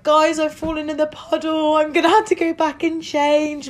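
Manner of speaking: high, hammed-up distress; fake upset. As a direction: play the wounded victim. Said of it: "got really upset and was like".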